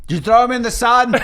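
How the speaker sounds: loud laughing tease; laughing shout. Real, not acted. Player teases friend about the sun through laughter.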